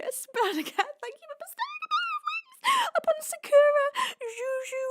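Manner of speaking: high, sobbing voice; crying thanks. Real, not acted. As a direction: thank them through tears. Crying while thanking donor.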